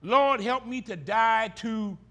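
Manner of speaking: slow, weighty plea to the Lord; prayer refrain. Acted. Preacher leading the congregation in prayer, slow plea. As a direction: pray it slowly, meaning every word.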